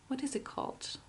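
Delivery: whispered under her breath; whispering. Real, not acted. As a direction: whisper it to yourself, searching for the word. Repeats the question to herself.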